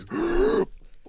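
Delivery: gasp. Retold or imitated. Whippets user high voice.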